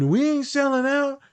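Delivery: high, slow defiant student voice; mock indignant students. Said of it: "And they said".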